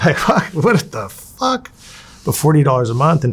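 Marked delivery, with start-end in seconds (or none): 0.0-1.7 slow, laughing, pitched-up incredulous curse; laughing disbelief. Real, not acted. Inmate hearing his tiny prison wage, slow laughing disbelief. Disbelief at forty-dollar pay.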